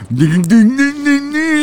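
high, loud nonsense syllables; mock the sounds they made; mocking imitation. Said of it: "that's how they sounded".

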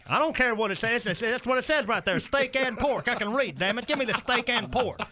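worked-up comic uncle; indignant uncle. Acted. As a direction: argue stubbornly over an order. Uncle argues over his order.

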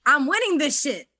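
high, gleeful victory chant; triumphant, rising to an excited shout. Retold or imitated. Player retells her thought on returning, excited and high. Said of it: "I was just so excited".

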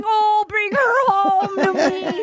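high, drawn-out hammed-up wail; high, slow mock keening; mock pleading mom. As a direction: imitate a pleading mother, dragging every word. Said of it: "Pilot Pete's mom was in the audience".

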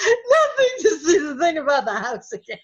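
high, squeezed imitation of helpless laughing; imitating a man overcome with laughter; crying with laughter. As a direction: wheeze it out as if laughing to tears. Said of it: "tears rolling down his face".

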